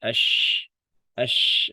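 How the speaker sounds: a teacher drilling the letter shin with sukun; hushed sh sound. Shown as a demonstration. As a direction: sound the sh of "ash" as a broad steady rush of air. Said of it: "Tengah lidahnya ngangkat".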